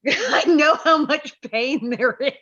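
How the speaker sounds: slow laughing sympathy for the actors. Real, not acted. Laughs at costume dramas.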